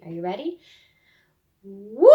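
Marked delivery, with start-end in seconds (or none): 1.6-2.1 rising woo as the ball flies up; ball whoosh. Shown as a demonstration. Teacher showing the class a ball sound, rising woo. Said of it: "I'm gonna show you once more".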